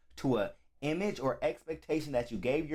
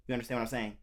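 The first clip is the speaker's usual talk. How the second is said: ordinary talk, nothing marked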